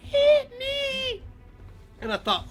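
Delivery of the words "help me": very high falsetto cry; wee girl crying for help. Acted. Oberon hearing girl in the woods, high cry. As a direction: wail it as a tiny girl. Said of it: "heard the wee little girl crying".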